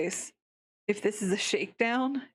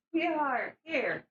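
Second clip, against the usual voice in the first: calling the pet; 7 semitones higher than usual. Real, not acted. Calling her pet over.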